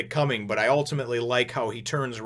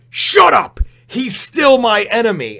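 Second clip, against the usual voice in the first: much higher, much louder furious scream of "Shut up!"; furious shout; 10 semitones higher, 11 dB louder, slower than usual. Retold or imitated. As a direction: scream it, out of control with rage. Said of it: "balls to the wall crazy".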